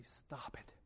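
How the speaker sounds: whispered firm stop it; loving but firm. Retold or imitated. Holy Spirit correcting the preacher, firm whisper. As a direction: hush it lovingly yet firm. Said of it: "very lovingly but very firmly".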